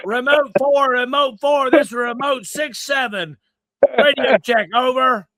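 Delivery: loud, high radio call; over walkie talkie. Pretend. Radio call on remote post.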